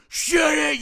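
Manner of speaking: sudden high, loud shouted snap; yelling. Retold or imitated. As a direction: blast it out like an unhinged screamer. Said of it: "like, yelling".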